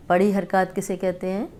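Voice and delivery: ordinary delivery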